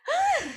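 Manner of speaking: short high frustrated "ah"; frustrated cry. Acted. Preacher voicing a worn-out minister, high frustrated cry.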